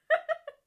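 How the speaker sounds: high loud burst of laughter. Real, not acted. Cheering the son on, a loud laugh. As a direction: cackle out loud.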